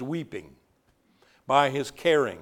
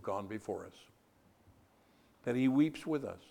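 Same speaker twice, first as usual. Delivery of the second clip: tender; 5 semitones lower, 8 dB quieter than usual. Real, not acted. Preacher softly comforting the bereaved. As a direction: hush down and comfort the grieving.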